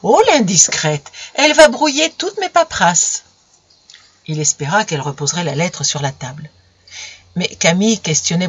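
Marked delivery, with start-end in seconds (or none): 0.0-3.2 higher, playful mock scolding; affectionate scolding. Romain gently scolding Camille for reading his papers. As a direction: tease her fondly as you chide. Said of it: "sur un ton de gronderie affectueuse".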